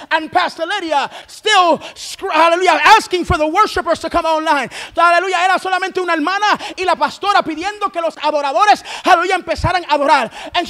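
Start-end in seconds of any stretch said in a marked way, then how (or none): none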